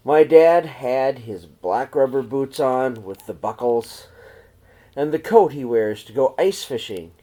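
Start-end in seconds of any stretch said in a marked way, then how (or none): none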